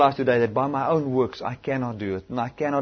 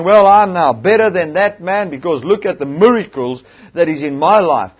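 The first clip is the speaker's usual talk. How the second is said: mock boastful preacher; 4 semitones higher, 10 dB louder than usual.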